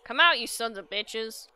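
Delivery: shouted challenge. Acted. Farmer challenges creatures.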